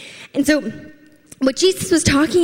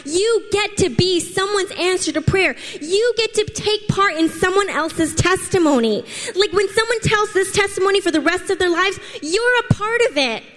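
higher, lifted preaching voice building excitement; rousing preaching; 6 semitones higher than usual. Acted. Pastor urging the church to rise up, higher fervent preaching. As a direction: rise up and stir the congregation.

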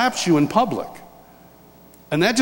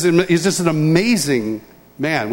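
ordinary talk, nothing marked

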